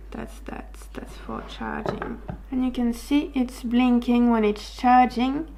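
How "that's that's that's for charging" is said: whispering. Real, not acted. Testing mic, whispered aside.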